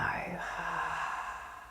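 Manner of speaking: long open-mouthed sigh; audible, unvoiced exhale of release; letting-go sigh. Shown as a demonstration. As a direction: sigh it all out on a falling breath. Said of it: "open your mouth and sigh".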